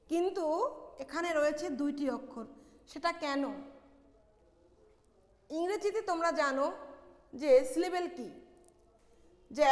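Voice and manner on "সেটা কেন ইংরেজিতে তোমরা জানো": ordinary talk, nothing marked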